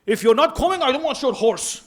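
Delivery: mock a stern dismissive preacher's version; a hint of a scornful refusal; harsh imitated rebuke. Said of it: "the person changes the tone of Imam Hussein".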